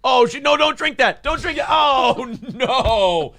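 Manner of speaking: high, loud panicked cries; panicked shouting. Acted. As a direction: shout in a panic to stop them. Acted panic at the window.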